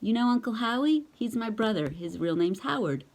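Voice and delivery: usual speaking voice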